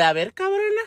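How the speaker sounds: high little rat voice; high-pitched mocking creature; defiant rat. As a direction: dare them in a squeaky falsetto.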